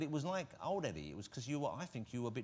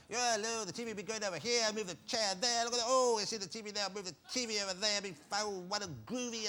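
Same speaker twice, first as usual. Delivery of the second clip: put-on high, loud rambling drunk man; drunk Eddie impression; 7 semitones higher, 4 dB louder than usual. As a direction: play a giddy deranged drunk. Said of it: "That's a good impersonation of him".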